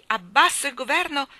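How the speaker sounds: defiant roar of the crowd; redoubled shout. Acted. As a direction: shout it back at the bayonets.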